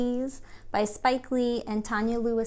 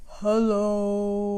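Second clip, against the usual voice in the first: a hint of a deep hello; low hello; 3 semitones lower, 5 dB louder than usual. Shown as a demonstration. Teacher shows the kids a low hello. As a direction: drop the voice down. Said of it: "Let's say hello as low as we can".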